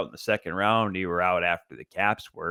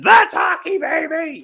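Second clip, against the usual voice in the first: high, booming mock broadcast yell; excited announcer shout; 8 dB louder, slower than usual. Podcaster mimicking the rival booth's goal call, excited shout. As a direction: belt it out like an overexcited play-by-play caller.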